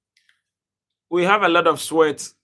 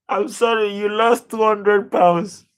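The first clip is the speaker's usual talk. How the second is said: stunned disbelief; 4 semitones higher, 4 dB louder than usual. Real, not acted. Viewer lost a big bet.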